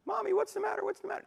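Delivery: put-on high, small toddler voice, anxious; little child voice. Toddler asks his weeping mother what's wrong, in a high small voice. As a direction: ask like a worried two-year-old.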